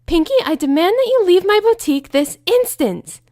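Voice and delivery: high, loud haughty demand; high loud offended command; indignant Rarity. Acted. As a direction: order her out at once.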